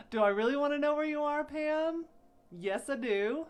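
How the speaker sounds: loud, high, quick question; excited teasing. Real, not acted. Host teasing Pam about her whereabouts, loud excited question. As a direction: tease her loudly, pitched right up.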